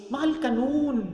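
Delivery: usual speaking voice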